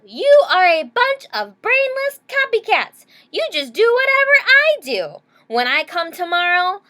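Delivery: high, loud furious yell; a fed-up girl yelling at the class. Acted. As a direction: scream it at the copycats. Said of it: "Stephanie yelled".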